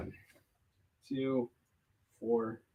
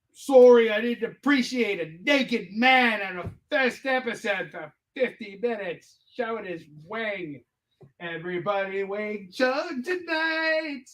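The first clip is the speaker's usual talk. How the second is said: high, slow put-on voice; sarcastic apology, stretched out; mock snarky apology; 7 semitones higher, slower than usual. Mock apology to Neil Gaiman.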